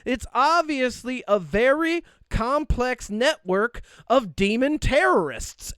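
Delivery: drawn-out imitation of a Southern pundit; Southern accent. Host mimics a news guest in a Southern accent. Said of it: "a deep Southern accent who's like".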